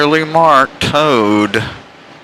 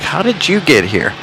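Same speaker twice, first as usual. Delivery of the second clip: God questioning a newcomer; 7 semitones higher than usual. Pretend. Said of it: "God will be like".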